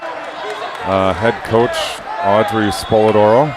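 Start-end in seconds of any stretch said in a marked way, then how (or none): none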